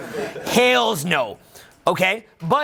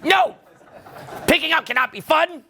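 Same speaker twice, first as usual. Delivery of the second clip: higher mock whine of a sceptical student; mocking the doubter; 7 semitones higher than usual. Coach mimics a viewer who insists picking up is war, mock whine. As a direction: ham up the naysayer who thinks dating is war. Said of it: "You're like".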